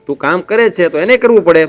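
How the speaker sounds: usual speaking voice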